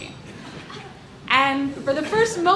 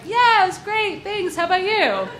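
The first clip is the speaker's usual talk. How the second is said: chirpy polite reply; 8 semitones higher, 4 dB louder than usual. Retold or imitated. Polite small talk with stranger.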